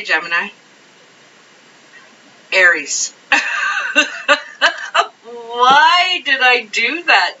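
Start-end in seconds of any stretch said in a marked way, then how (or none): 3.2-7.4 high, drawn-out laughing wail; real laughter spilling into the words; laughing in disbelief. Real, not acted. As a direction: crack up at your own slip. Laughs at her own slip.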